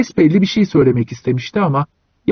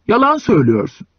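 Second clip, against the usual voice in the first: angry; 6 semitones higher than usual. A narrator's reading. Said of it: "Öteki uzun boylu kızdı ona".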